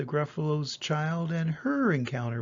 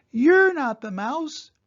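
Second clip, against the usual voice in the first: put-on much higher, louder little monster child; 9 semitones higher, 7 dB louder than usual. Child confronts the snake, much higher. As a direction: call it out high and a touch cross.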